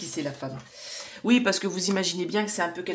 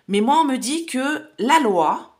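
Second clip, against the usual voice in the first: emphatic; 7 semitones higher, 7 dB louder, slower than usual. Real, not acted. Emphatic turn in the reading.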